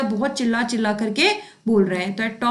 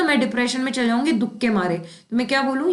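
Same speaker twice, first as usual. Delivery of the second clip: ordinary delivery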